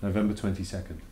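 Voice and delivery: usual speaking voice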